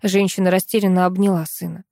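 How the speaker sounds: ordinary delivery, nothing marked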